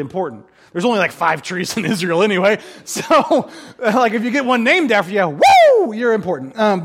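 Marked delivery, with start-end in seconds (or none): 5.3-5.9 high, loud whoop; playful party "woo"; celebratory woo. Acted. Preacher cheering anyone with a tree named after them, whooping.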